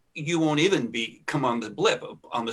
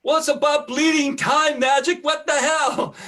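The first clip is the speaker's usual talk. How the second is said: much higher and louder, a booming mock greeting; 12 semitones higher, 8 dB louder than usual. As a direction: boom it out like a delighted old friend.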